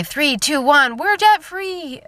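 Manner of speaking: high, drawn-out celebratory cry; debt-free scream. Retold or imitated. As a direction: count down and burst out in triumph. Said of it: "And then they'll just say".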